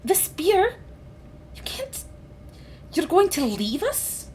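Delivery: high, hurt disbelieving questions; upset sister. Acted.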